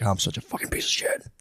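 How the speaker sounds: whispered under the breath; whispered self-reproach. Retold or imitated. Catching his own mind lying, whispering self-reproach. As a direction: hiss it at yourself in shame. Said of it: "and you're like".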